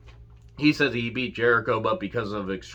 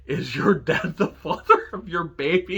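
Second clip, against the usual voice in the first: mocking MJF insult; 5 semitones higher than usual.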